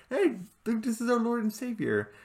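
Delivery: high, chirpy mock announcement; mock high reveal. Vlogger mocking a family member showing off the spider bot, high. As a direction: pitch it up in mock cheer.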